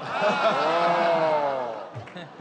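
bright laugh before the joke. Real, not acted. Teller laughing before his joke, light laugh. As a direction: laugh into the story.

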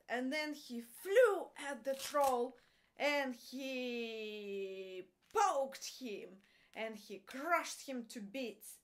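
ordinary delivery

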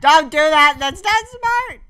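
high, loud, slow scold. Real, not acted. Player scolds partner for a bad jump, loud and high.

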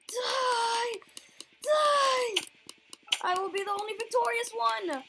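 loud cartoon villain ranting; mad scientist. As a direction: shout like a gloating supervillain. Playing villain in game.

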